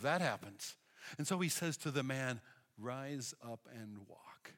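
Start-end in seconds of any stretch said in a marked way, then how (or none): 2.8-4.5 "Rise up and walk" said very softly and slowly, each word weighted; soft, slow command to the paralysed man; gentle command. Retold or imitated. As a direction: speak the healing command softly, drawn out.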